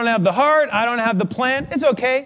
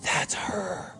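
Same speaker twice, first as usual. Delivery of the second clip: hushed, stunned recognition; tearful whisper; 10 dB quieter than usual. Retold or imitated. Elderly lady recognizing her helper's name, tearful whisper. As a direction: whisper it as if overcome. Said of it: "A tear starts to form".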